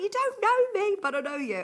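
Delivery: imitating an excited viewer; excited fan. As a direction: gush like a delighted fan. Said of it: "She was like".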